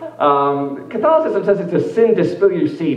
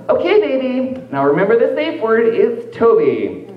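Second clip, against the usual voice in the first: higher, coaxing put-on voice, slowing at the end; mock dominant partner; 8 semitones higher, slower than usual. Partner setting the safe word, higher and coaxing. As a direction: tease the partner as a playful master. Acted kink roleplay dom voice.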